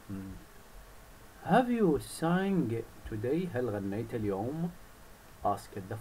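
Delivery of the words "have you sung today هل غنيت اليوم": slow, drawn-out fox question; slow sly question. Acted. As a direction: coax the bird slowly. (الثعلب يسأل الطير ببطء ومكر)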